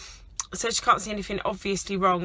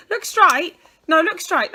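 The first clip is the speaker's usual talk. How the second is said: louder, higher firm instruction; 8 semitones higher, 8 dB louder, slower than usual. Real, not acted. Mum telling daughter to look at camera, firm and raised.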